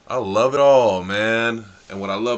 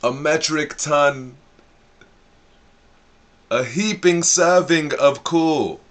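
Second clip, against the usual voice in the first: louder, slower, pumped-up praise; hyped exaggeration; 6 semitones higher, 5 dB louder, slower than usual. Real, not acted. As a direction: pile it on with glee. Hyping up the praise.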